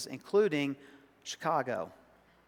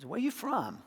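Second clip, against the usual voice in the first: a higher South Arkansas twang on the question; South Arkansas accent; 6 semitones higher, 5 dB quieter than usual. Shown as a demonstration. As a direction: ask it like a small-town South Arkansas local. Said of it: "I still sound like that occasionally".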